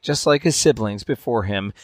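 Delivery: usual speaking voice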